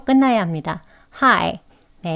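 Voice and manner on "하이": 하이 ending in 이 with 에 jaw opening; correct hi. Shown as a demonstration. Teacher shows the correct 하이, careful demo. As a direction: say 하이 with the jaw open. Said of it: "입을 에 모양만큼은 벌린 상태에서".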